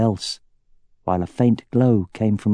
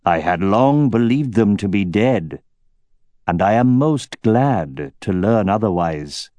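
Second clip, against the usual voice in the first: deep, rich voice of authority. Acted. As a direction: speak like a menacing king on his throne. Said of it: "deep and rich, and imbued with authority".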